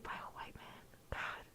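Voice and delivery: whispering. Real, not acted. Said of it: "I have to whisper".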